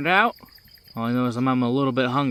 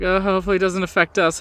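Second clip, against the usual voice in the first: mock bystander; 7 semitones higher, 4 dB louder, faster than usual. Bystanders voiced by GM.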